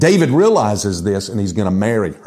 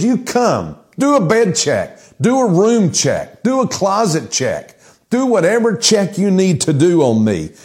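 high-pitched, insistent acted plea; acting out a bold open challenge; challenging invitation; 7 semitones higher than usual. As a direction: invite inspection, each demand pitched high. Voices David inviting God's inspection.

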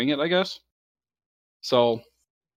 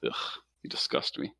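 mock disgust; 8 semitones lower, 10 dB quieter than usual.

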